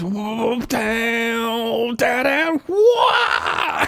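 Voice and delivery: high, loud, laughing whoa down down whoa; ecstatic mock wail. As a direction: belt it out like the theme is hitting you. Imitating soundtrack scream excitedly.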